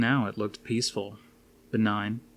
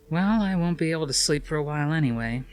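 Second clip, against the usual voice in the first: higher, quick woman's voice; Suzanne's voice; 7 semitones higher, 4 dB louder, faster than usual. A narrator's reading. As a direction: voice Suzanne, resigned.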